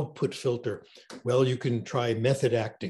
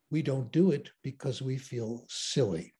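ordinary talk

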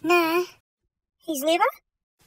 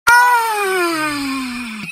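imitated cry; 5 dB louder than usual. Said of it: "but he was like".

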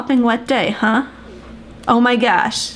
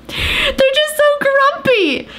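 cutesy high voice; 12 semitones higher, slower than usual. Pretend. Mocking grumpy old men.

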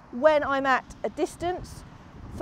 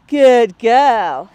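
loud praise; 3 semitones lower, 11 dB louder than usual. Real, not acted. Trainer praising Magpie loudly. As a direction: reward the dog with your voice.

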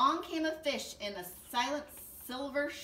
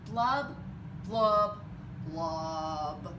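blub, blub, blub sinking lower each time; gloomy fish blubs; 7 semitones lower, 4 dB louder, much slower than usual. Acted. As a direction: let each blub drop deeper, glum. Fish's refrain, sinking gloomy blubs.

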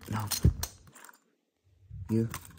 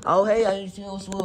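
goofy put-on voice; 9 semitones higher than usual. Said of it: "I wouldn't be like".

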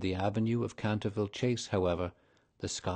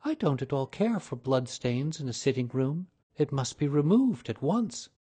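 indignant lady; 5 semitones higher, 3 dB louder than usual. A narrator's reading. Mrs Otis demands stain removed.

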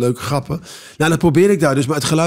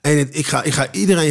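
ordinary talk

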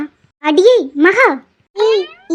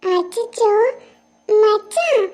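put-on high, soft voice of a small girl; a little girl worried for her crying friend; childlike and soft; 6 semitones higher, 4 dB quieter, much slower than usual. Little girl comforting crying boy.

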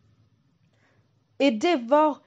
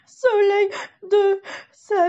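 put-on high, slow crying little girl; begging through tears; tearful pleading; 11 semitones higher, 6 dB louder, slower than usual.

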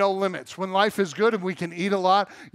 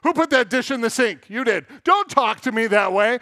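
mock-irritated dad barking at the kids, loud and higher; raised, testy voice in playful re-enactment; barky dad; 4 semitones higher, 6 dB louder than usual. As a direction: bark it like a cranky father. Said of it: "Dad gets a little barky".

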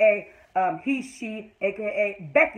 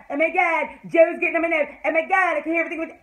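mock whining; 6 semitones higher, 4 dB louder, faster than usual. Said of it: "bitching and moaning".

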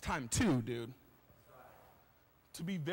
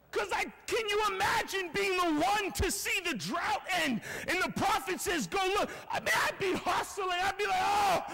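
high, excited shouting; can barely contain your excitement, voice raised; thrilled servant; 7 semitones higher than usual. Acted. Servant racing to see the drought end, thrilled shouting. Imagines being the servant running.

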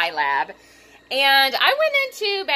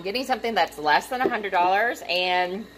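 usual speaking voice

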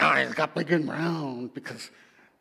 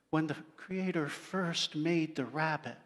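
plain reading voice

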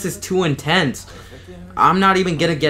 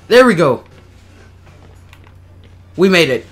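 relieved; 3 semitones higher, 7 dB louder than usual. Real, not acted. Reaching the end.

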